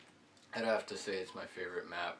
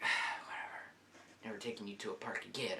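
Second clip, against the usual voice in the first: dismissive whisper; 4 dB quieter, faster than usual. Retold or imitated. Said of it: "She's like".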